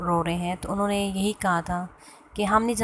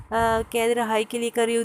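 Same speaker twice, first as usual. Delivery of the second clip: ordinary talk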